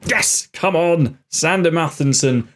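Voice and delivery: high, jubilant outburst; real excitement at scoring; excited goal celebration. Real, not acted. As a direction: celebrate the goal, pitch jumping up. Celebrating a goal just scored.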